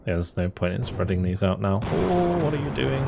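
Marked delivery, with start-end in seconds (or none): 1.8-3.0 surprised. Real, not acted. Unit makes a bad move.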